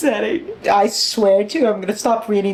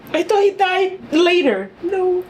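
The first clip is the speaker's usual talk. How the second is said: high, tearful wail; upset high-pitched protest; distraught denial; 7 semitones higher than usual. Real, not acted. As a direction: cry out, half laughing, refusing it. Reacting to character's death.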